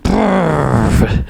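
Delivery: buzzing mouth-made blast noise; imitated explosion. Retelling Rambo blowing up the helicopter, imitated explosion. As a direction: blow out a rumbling explosion sound. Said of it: "Blows that motherfucker out, like".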